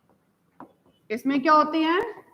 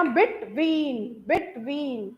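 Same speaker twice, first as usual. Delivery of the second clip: "between" in two separate beats, bet - ween; split into syllables; 2 semitones lower, 4 dB quieter, much slower than usual. Shown as a demonstration. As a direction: break "between" after the first syllable. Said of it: "दो जगह break है ना". ("between" को दो जगह तोड़कर)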